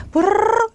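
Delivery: brrr like a passing motorbike; motorbike trill. Shown as a demonstration. Teacher suggesting practice, trilled motorbike sound. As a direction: roll the tongue in a long motor noise. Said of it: "오토바이가 지나간다".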